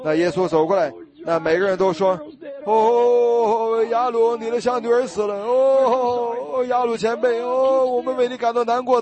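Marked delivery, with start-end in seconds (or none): 2.6-9.0 high, keening mock lament; mock mourners' pity; wailing mourners. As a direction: pitch it up like fussy mourners.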